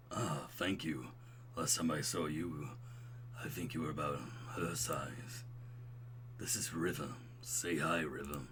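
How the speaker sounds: low, soft laid-back dude; smooth and buttery. Acted. Old roommate introducing his baby, smooth low voice. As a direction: talk low and mellow. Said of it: "I'm making him sound like".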